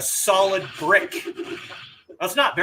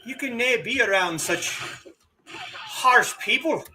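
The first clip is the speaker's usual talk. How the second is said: hammed-up Scottish brogue, pitched higher; mock Scottish accent; 7 semitones higher than usual. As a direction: play a terrible stage Scottish accent for laughs. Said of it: "terrible, terrible accent".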